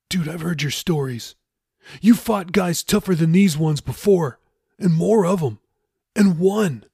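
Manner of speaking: lightly voiced pushy shipmate; Jeffy, insistent.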